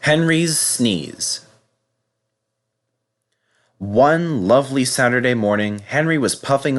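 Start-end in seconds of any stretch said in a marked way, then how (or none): none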